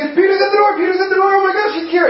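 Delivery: high-pitched squeals of excitement; excited screaming. Retold or imitated. Girl shrieking to the church that Peter is at the door. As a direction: shriek the news like a thrilled girl. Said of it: "starts screaming".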